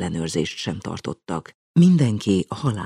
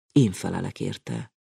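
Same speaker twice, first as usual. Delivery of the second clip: plain reading voice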